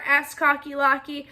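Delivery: ordinary delivery, nothing marked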